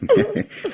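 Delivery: a quick, high chuckle; amused laugh. Acted. The inspector chuckling at the fretful maid, high amused laugh. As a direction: chuckle at her superstition.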